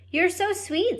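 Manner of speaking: high, sweet princess voice; a young princess cooing at a tiny mouse, high and sweet. Acted. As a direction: coo it like a fond princess. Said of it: "said the princess to Despero".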